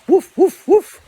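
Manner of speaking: loud, high dog barks; loud barking. Retold or imitated. Dog barking at dogs beyond the fence, loud and high. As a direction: bark hard at the strangers over the fence.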